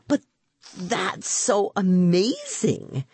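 slow, high-pitched wonder; marvel, stretching the words out; amazed. Acted. Rima marvels at Harry as a bluebottle, amazed.

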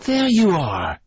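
high, loud triumphant retort. A narrator's reading. The widow triumphs over the old man's answer. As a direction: pounce on the proof.

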